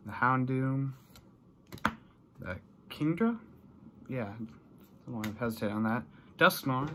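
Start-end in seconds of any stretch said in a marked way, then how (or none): none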